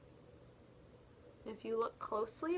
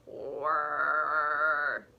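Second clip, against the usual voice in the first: mixer whirr; 8 semitones lower, 6 dB louder than usual. Acted.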